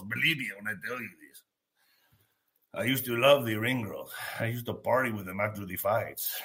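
low, quiet suggestive aside. Real, not acted. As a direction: drop low, hint at it.

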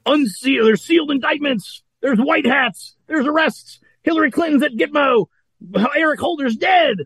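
high sarcastic list of claims; mocking. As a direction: parody a conspiracy believer.

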